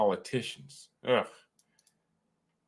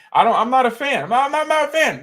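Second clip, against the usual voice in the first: high, loud repeated protest; mock outburst; 13 semitones higher, 10 dB louder, faster than usual. Streamer insisting he's no corn fan, mock outburst. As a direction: blurt it over and over, climbing higher.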